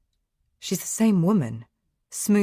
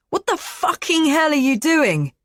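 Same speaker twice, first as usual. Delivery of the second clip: high, loud enraged yell; furious shout; 12 semitones higher, 7 dB louder than usual. A narrator's reading. Naked man yelling at the intruder, furious shout. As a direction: bellow at the intruder. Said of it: "he shouts".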